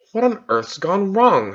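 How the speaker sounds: put-on girl startled the pool did not work, mildly frightened. A narrator's reading. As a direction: ask in mild alarm. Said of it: "said Polly in a frightened voice".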